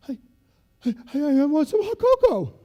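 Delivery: loud stuttering plea; a freezing recruit begging; retold stammer; stammering trainee.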